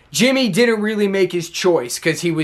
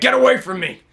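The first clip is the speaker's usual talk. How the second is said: Kevin Owens tantrum; 6 semitones higher, faster than usual. Retold or imitated. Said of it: "he's like".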